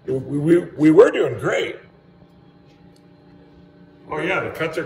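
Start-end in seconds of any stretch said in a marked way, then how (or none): 0.1-1.8 louder and slower, leaning on each word; we really were doing great, insist it; real exasperated emphasis; emphatic frustration. Real, not acted. Frustrated after blowing the breaker.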